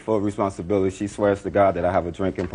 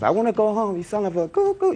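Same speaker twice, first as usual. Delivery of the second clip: mimicking girlfriend; 14 semitones higher than usual. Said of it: "she said".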